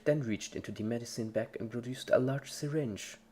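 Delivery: usual speaking voice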